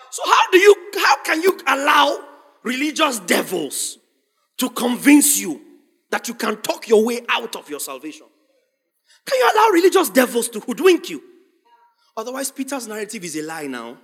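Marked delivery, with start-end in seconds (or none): 0.1-11.2 loud, rousing rhetorical challenge; forceful preaching. Real, not acted. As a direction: press the congregation with the question.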